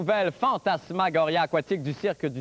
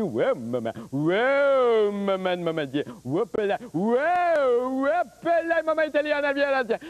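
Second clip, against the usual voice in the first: Monsieur Sou voice; 2 semitones higher, slower than usual. Acted. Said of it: "faire le monsieur Sou".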